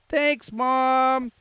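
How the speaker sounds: high, mock-sweet thanks; put-on high, drawn-out gratitude; grateful daughter. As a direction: playfully gush as a grateful daughter. Answers as the daughter, gushing.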